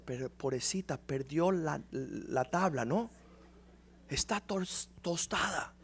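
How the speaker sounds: snide muttering at the praying woman; muttered aside. Retold or imitated. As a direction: mutter it so she can't hear. Muttering inner thoughts during prayer.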